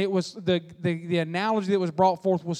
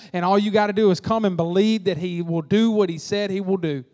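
ordinary talk

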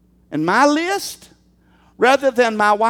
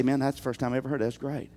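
rattled off fast and softer; mock polite reply; 11 semitones lower, 10 dB quieter, much faster than usual. Preacher voicing the congregation's fake-polite reply, fast and soft. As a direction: toss it off quick, fake-polite. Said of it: "you just say".

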